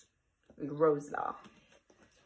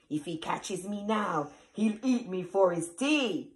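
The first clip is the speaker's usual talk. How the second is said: ordinary delivery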